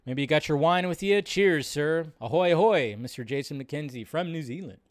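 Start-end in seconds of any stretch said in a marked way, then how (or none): none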